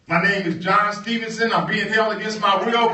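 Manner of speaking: loud and high, calling for rescue; radio plea. Retold or imitated. John broadcasts a plea for rescue over the radio, shouting. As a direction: shout it out to the whole world.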